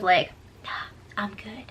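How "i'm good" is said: whispered, barely voiced; mouthed words. Retelling Jackson mouthing a reply, whispered. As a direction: mouth it silently back to her. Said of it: "he mouthed something along the lines of".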